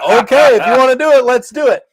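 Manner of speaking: high, loud "let's do it" with relish; a mock-bold dare, loud and high; loud eager acceptance. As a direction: hype yourself up to fight. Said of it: "He's like".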